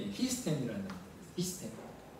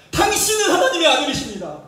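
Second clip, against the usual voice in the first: loud, high proclamation; loud, raised confession; demons crying out; 7 semitones higher, 8 dB louder than usual. Retold or imitated. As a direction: cry it out like demons falling down.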